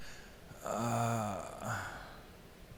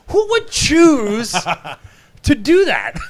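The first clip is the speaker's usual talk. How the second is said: incredulous; 13 semitones higher, 12 dB louder, slower than usual. Retold or imitated. Retorting incredulously to the fans.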